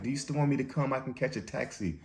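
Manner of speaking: mock sheepish girlfriend voice, pitched high; imitating girlfriend.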